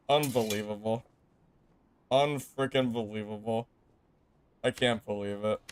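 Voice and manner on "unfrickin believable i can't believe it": ordinary talk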